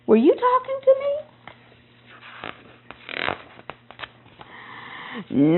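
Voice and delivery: farmer's squeaky high disbelief; startled farmer. Acted. Farmer startled by talking dog.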